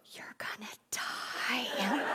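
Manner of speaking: slow, breathy-free whispered threat, half laughing; sinister whisper. Retold or imitated. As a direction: whisper it slowly like a playful villain. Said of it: "it came out real sinister".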